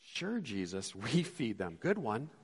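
quiet, dry sarcasm; mock agreement, rolling eyes; soft sarcastic brush-off. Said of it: "Rolling their eyes".

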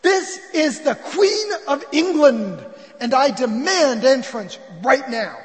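very loud, high, drawn-out royal demand; slow, furious proclamation, acted big; Queen Victoria. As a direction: bellow imperiously at a locked door. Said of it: "he gets the same answer".

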